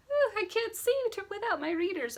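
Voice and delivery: put-on high, fluttery little old lady; cute old lady voice. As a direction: play a sweet old lady squinting. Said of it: "Like a cute old lady".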